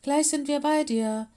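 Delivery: loud, high call across the rushing water; barking call. Acted. Mino calls loudly to the stranded wildcat. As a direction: bark it out loud to a friend. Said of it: "bellte Mino".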